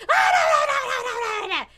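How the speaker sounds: put-on high, loud run of kids' hollering; mock panicked yelling. Kids fighting upstairs, mock panicked yelling. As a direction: yelp it rapidly at a high pitch.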